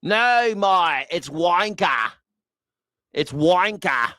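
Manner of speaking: playful mock Aussie, "wanker" stretched and high; mock Australian. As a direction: imitate an Aussie saying "wanker" slowly. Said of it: "how to say words like wanker".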